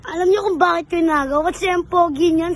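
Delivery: loud, fast taunting brag; shouting match. Acted. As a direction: boast it loud and quick. Bragging in comic quarrel.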